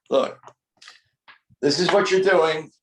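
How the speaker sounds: normal talking voice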